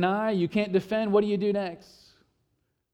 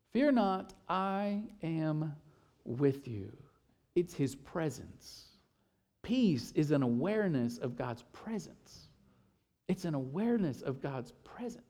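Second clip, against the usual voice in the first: much softer and lower, slow and intimate; soft tender; 4 semitones lower, 9 dB quieter than usual. Acted. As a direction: let it sink in, gently. Soft tender scripture close.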